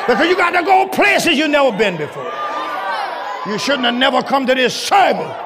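ordinary talk

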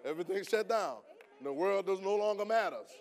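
loud, high shouted preaching; fiery preaching. Acted. Preacher tells the church how his father's call stops everything, preaching at a loud, high pitch. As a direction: preach it at full force to the room.

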